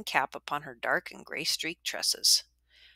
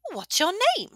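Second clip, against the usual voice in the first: high, loud, cackling tavern woman; boisterous old widow; 4 dB louder than usual. A narrator's reading. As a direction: hail an old friend across the room. Widow to Crispin.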